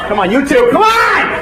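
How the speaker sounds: high shout at two holdouts; rallying cry. Pretend. Man urging two holdouts at the gate, high call. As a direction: call out the stragglers.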